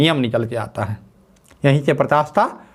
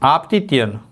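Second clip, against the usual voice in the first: dictation. Shown as a demonstration. Dictating first law.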